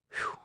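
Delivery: quiet relieved whew; soft exhale. A narrator's reading. Narrator sighs after the thrashing, soft. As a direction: blow out a breath.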